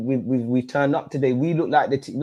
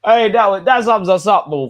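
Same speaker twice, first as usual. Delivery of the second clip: loud, high frustrated outburst; exasperated shout; 6 semitones higher, 8 dB louder, slower than usual. Real, not acted. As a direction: throw your hands up and shout. Fan exasperated at team.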